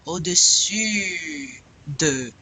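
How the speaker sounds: "Au-dessus de" very slow, the u long and held; stretched-out model of "Au-dessus de" with French u; rounded French U. Shown as a demonstration. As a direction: hold ee through rounded lips on the u of "Au-dessus de". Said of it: "round your lips like you're going".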